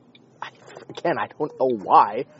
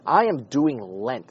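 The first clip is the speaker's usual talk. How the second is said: usual speaking voice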